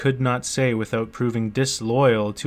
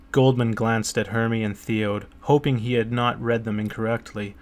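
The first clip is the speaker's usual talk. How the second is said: ordinary delivery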